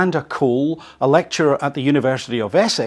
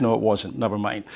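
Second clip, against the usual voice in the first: usual speaking voice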